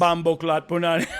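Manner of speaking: hammed-up Jamaican slang, laughing; mock Jamaican accent. Riffing with his co-host, he shouts slang in a mock Jamaican accent. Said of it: "bambo clat punani island boy".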